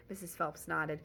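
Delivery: ordinary delivery, nothing marked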